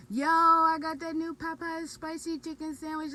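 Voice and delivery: loud, high boasting announcement; excited bragging. Retold or imitated. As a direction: brag about it to friends. Retold own bragging text.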